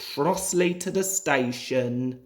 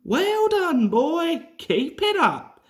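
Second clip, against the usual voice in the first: much higher, louder cheering on; encouraging; 11 semitones higher, 4 dB louder than usual. A narrator's reading. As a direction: urge the engine on, higher and louder. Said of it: "encouraged his driver".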